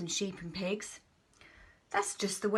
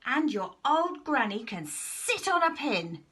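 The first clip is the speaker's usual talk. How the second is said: higher, slow, taunting pig; pig yelling; 7 semitones higher, 3 dB louder than usual. Acted. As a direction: sneer it out slowly as the pig. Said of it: "the third little pig yelled".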